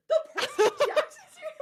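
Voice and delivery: high, loud squeal through laughter; excited squeal. Retold or imitated. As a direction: gush over the books, squealing. Retold excitement at kid's book.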